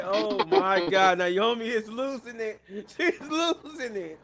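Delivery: high, slow laughing exclamation; tickled, drawn-out gasping commentary; amused disbelief. Real, not acted. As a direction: laugh through the words in disbelief. Laughing at Naomi's meltdown.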